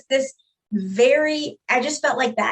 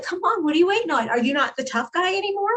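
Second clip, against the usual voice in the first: higher-pitched mocking dare; retold goading; 7 semitones higher than usual. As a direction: taunt him to act tough. Retelling how she goaded him.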